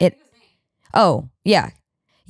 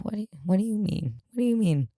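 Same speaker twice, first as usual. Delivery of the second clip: shocked whisper; 6 semitones higher, 6 dB quieter than usual. Retold or imitated. Shocked disbelief.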